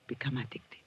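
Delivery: soft, voiceless whispered promise; teasing whisper. Acted. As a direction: let the words fade into a whisper.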